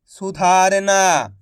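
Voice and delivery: सुधारना said whole, slowly; careful teaching read of सुधारना; slow blended word. Shown as a demonstration. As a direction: join the sounds into सुधारना. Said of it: "मिलके क्या बनेगा".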